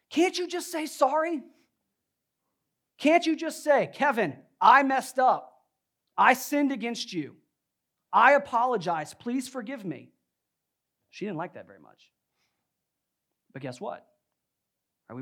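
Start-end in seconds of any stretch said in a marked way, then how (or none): 0.1-10.1 loud, high re-enacted demand; exasperated outburst. Husband demands an apology from wife, exasperated outburst. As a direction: act out a fed-up plea at raised pitch and volume.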